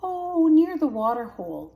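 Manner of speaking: high, loud innocent fib; young cub voice fibbing brightly. A narrator's reading. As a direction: cover the lie with breezy cheer. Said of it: "the cub fibbed".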